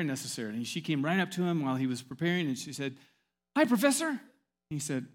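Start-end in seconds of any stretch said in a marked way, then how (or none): 3.6-4.2 lightly voiced eager girl, pitched up; sing-song hello in a high, odd voice; mock cheery student greeting; a touch of a high-pitched quirky student. Cuckoo student voiced in story.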